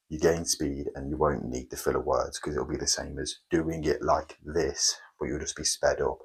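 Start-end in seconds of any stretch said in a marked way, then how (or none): 3.8-4.9 slow and spaced, each word pressed; deliberate, word by word; slow, every word stressed. Shown as a demonstration. Coach showing sped-up method versus slow version, slow.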